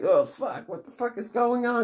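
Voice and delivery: high, mock-panicked kid voices. Kids with the dog panic, high alarmed wail. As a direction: squeal it like alarmed children.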